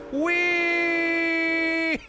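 long, high, loud squeal of glee; gleeful "whee". Real, not acted. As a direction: hold out a joyride shriek. Delighted ride on the train.

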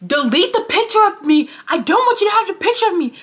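high, loud mock shriek; imitated screaming. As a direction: mimic someone screeching in outrage. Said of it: "They're screaming".

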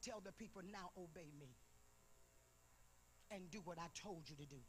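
low, soft voice of authority; God's quiet command. Retold or imitated. Preacher relaying God's word to the people, low quiet command. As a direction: deliver the order low and hushed. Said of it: "this is the way he said it".

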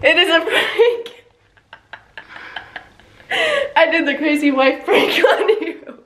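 real laughter breaking through the words; laughing reveal. Real, not acted. Wife reveals prank to partner, laughing. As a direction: crack up while confessing.

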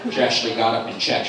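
ordinary delivery

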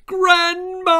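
high, loud call for Grandma; a child's frightened shout into the night. A narrator's reading. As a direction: yell out for help in the dark. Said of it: "I shouted".

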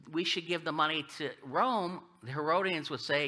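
usual speaking voice

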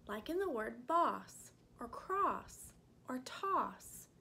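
usual speaking voice

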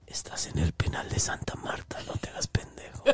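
low unvoiced menacing whisper; spooky priestess voice. Naming his prison to scare him, a low unvoiced whisper. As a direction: hiss it like a witch. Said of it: "Pero con mi voz".

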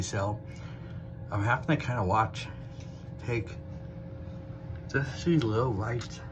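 talking with teeth clenched; speaking mid-bite; biting into cheese. Real, not acted. Seen 0: cheese stick held between teeth, biting while speaking.

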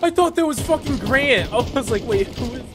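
high, loud startled yell; startled shout. Real, not acted. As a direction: jump at a sudden scare. Startled by teammate in game.

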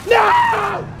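sudden high yelled no; shouted no. Real, not acted. Gamer getting shot by helicopter, sharp shout. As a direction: cry out as you get hit.

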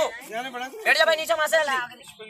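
usual speaking voice

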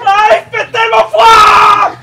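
cri aigu, lent et fort; cri de froid. Acted. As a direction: hurler de froid en traînant. Improv, cold shock outside. (cri de froid, lent et fort)

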